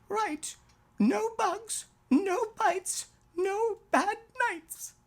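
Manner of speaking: put-on high, sing-song child's chant; relieved little girl. Daughter repeating the comfort back to daddy, high and sing-song. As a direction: recite it like a reassured small girl.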